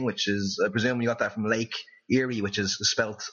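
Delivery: ordinary talk, nothing marked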